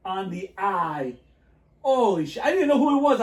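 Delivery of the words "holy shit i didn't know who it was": loud, high burst of amazement; excited. Retold or imitated. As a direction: blurt it out, thrilled. Excited memory of note.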